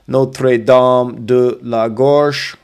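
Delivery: very slow French Notre Dame de la Gorge; French pronunciation. Shown as a demonstration. Guide modelling French place name. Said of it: "don't pronounce the S".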